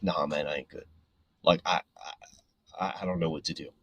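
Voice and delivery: low, soft, halting imitation of a downcast kid; understated defeat, pitched low and quiet; dejected. Upset young helper answering his coworker, mildly dejected.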